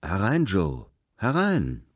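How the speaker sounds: hearty summons across the room; calling out. A narrator's reading. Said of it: "rief er".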